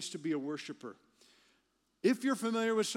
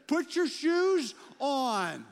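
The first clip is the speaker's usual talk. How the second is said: slow, louder and higher, every word hammered; slow stern command; 8 semitones higher, 7 dB louder, much slower than usual. Retold or imitated. Dad finally snapping at the kids about shoes, slow and stern. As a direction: lay down the law, word by word.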